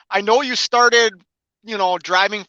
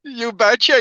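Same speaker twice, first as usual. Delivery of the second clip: put-on Minnesota accent; 5 semitones higher than usual. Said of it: "a Minnesota boy like me".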